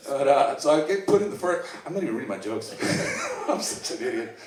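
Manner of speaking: ordinary talk, nothing marked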